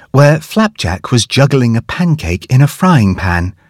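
ordinary delivery

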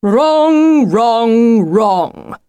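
drawn-out wrongs, starting high and loud, stepping down; wolf calling out. A narrator's reading. Hidden wolf correcting Lucy from the undergrowth, calling out. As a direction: call out a correction, dropping with each word. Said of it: "came a voice from the undergrowth".

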